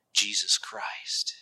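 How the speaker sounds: slow, near-whispered name; hushed reverence. Real, not acted. Preacher naming the rock to the congregation, hushed. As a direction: drop to a slow, reverent hush.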